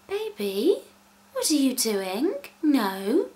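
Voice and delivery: put-on high, cooing talk to a toddler; sing-song mock parenting voice, swooping in pitch; put-on baby-talk voice. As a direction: gently scold as if to a baby. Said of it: "I don't know why I'm talking like this".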